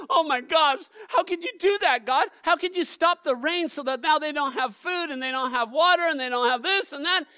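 high, loud, shouted outrage at God; indignant complaint. Retold or imitated. People accusing God over the drought, a shouted complaint. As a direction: hurl the accusation upward. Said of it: "Like, if we're just looking at it from ourselves".

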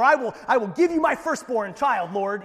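high, shouted over-the-top pledge; psyched-up vow. Retold or imitated. Preacher mimicking a rash bargain with the Lord, hammed-up shout. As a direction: promise far too much, at full volume. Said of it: "you're kinda psyching yourself up".

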